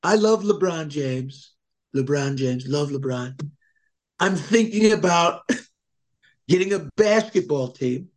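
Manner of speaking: retelling a funny Trump video in his voice; Trump impression. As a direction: ramble proudly like Trump. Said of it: "He goes".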